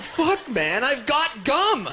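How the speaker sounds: high, loud, last words drawn out; mock-exasperated cry, slowing at the end; exasperated outburst. Nephew answers uncle's excuse about hiding breath, loud exasperation.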